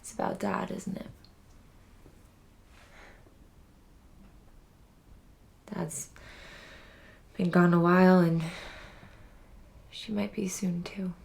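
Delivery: hushed, halting, near whisper; subdued grief. Acted. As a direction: break painful news very softly, with long pauses. Breaking news that mother is dying.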